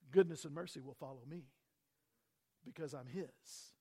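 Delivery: ordinary delivery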